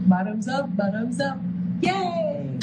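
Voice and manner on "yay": cheer. Real, not acted. Cheering after the penalty shot.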